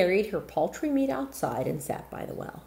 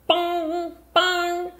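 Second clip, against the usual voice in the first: clock chime; 11 semitones higher, 4 dB louder than usual. Acted. Clock chiming midnight.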